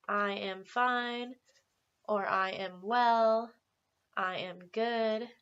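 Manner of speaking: slow, clear teacher modelling; slow model answers. Shown as a demonstration. Teacher modelling sample answers slowly for students. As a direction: give each answer slowly. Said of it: "most people will say".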